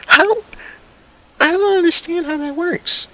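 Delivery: high, loud disbelieving cry; incredulous. Real, not acted. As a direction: throw up your hands in disbelief. Exasperated disbelief.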